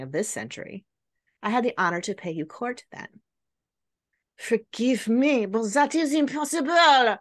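hammed-up French accent for the count and countess. Storyteller playing the count, bad French accent. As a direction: ham up a French aristocrat. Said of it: "My horrible French accent".

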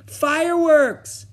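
high, loud, drawn-out cheer; delighted exclamation. A narrator's reading. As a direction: exclaim like an excited little boy. Small boy delighted by the rocket.

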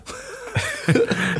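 sudden high giggle; high laugh. Real, not acted. Laughs at joke. (hohes Kichern)

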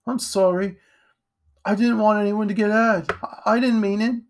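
put-on high-pitched, contrite boy; upset apology. A narrator's reading. Jack says sorry to Edgar, upset and contrite.

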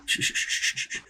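quick unvoiced shushing to silence the room; hurried shh as someone comes back; hushing shh. Real, not acted. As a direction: shush urgently before he walks in. Hushing as co-host returns.

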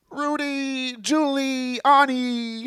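slow, high, drawn-out announcer; Cosby impression. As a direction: stretch the name out like a host. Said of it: "Be like".

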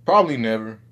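usual speaking voice

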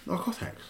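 whispery, odd little "narcotics"; weird pronunciation. Shown as a demonstration. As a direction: repeat "narcotics" strangely, barely voiced. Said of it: "really weird way of saying narcotics".

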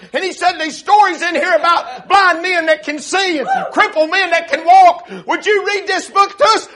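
high, loud revival storytelling; full-force preaching. Retold or imitated. Preacher retells boy bringing Testament home to Mama, full-force preaching.

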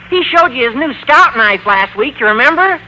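ordinary delivery

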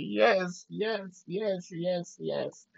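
excited yeses. Retold or imitated. Fan reliving Tobey's entrance on screen, excited yeses. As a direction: replay a thrilled fan's chain of yeses. Acting out his reaction to entrance.

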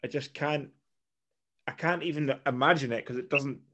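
ordinary delivery

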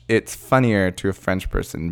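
usual speaking voice

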